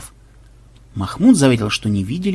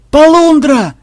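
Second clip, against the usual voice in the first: very high, loud drawn-out yell; yelled alarm; 13 semitones higher, 7 dB louder, slower than usual. A narrator's reading. Lookout yelling alarm to the crew, high and loud. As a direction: yell a sudden warning to all hands. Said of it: "вдруг завопил кто-то".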